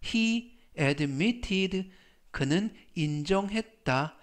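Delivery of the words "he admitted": slow demo. Shown as a demonstration. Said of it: "T를 하나 더 쓰고 이디를 붙여줍니다".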